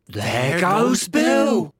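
long drawn-out high holler; stretched-out group cry; general chorus. A narrator's reading. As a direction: shout it slowly as a crowd watching him fly. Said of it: "a general chorus of".

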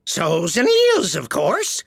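rather impatient. A narrator's reading. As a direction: answer impatiently in a creature voice. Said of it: "replied rather impatiently".

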